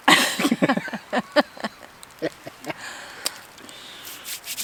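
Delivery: loud squealing laugh; bursting out laughing; high laugh. Real, not acted. Laughing at the stick.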